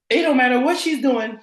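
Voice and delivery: usual speaking voice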